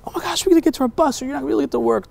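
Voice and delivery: high, quick chatter of a woman hurrying to the bus; flustered rush. Retold or imitated.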